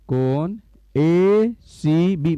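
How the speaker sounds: ACB stretched very slowly, letter by letter; drawn-out dictation. Shown as a demonstration. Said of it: "कैसे लिखोगे". (कोण ACB को बहुत धीरे, खींचकर बोलना)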